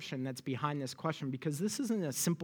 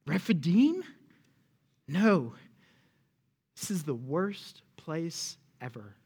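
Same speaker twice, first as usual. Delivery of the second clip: disgusted complaint. Acted. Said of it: "You can hear it".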